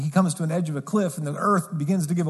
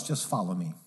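soft invitation; 9 semitones lower, 9 dB quieter than usual. Retold or imitated. Jesus's invitation quoted softly.

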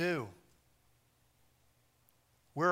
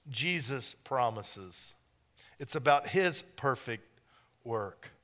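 soft sincere; 5 semitones lower, 8 dB quieter than usual. Real, not acted. Sermon drops to soft point.

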